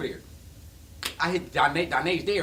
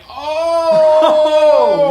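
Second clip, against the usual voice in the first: much louder, startled shout with a laugh; Matrix dodge; 10 semitones higher, 17 dB louder than usual. Retold or imitated. Reacting to the girlfriend's bold question, loud startled laughing shout. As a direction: jerk back like dodging bullets. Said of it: "I did the Matrix".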